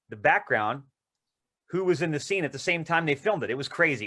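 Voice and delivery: ordinary talk, nothing marked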